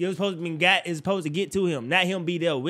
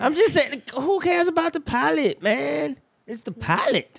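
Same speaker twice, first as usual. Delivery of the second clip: high, drawn-out exasperated protest; real incredulous pushback, pitched high; exasperated insistence; 10 semitones higher, slower than usual. Real, not acted. Arguing back at co-host's objection.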